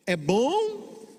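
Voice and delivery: BOM stretched on a high rising tune; prompting a repeat. Shown as a demonstration. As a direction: lead the crowd, lifting BOM and leaving it hanging. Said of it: "Vamos lá".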